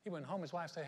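ordinary delivery

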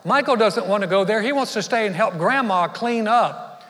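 put-on high, loud insistent little girl; bossy sister. Sister overruling grandpa for her brother, bossy. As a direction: boss her way into the trip. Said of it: "Brittany spoke up".

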